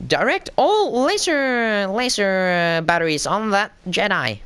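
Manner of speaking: high, slow mock droid order; droid officer. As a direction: imitate a droid commanding, drawn out and high. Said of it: "and he says".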